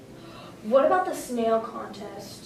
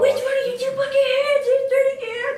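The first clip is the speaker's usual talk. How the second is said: high loud cartoon voice; SpongeBob quote; 4 dB louder than usual. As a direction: belt the line like the cartoon character. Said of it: "That's my favorite episode".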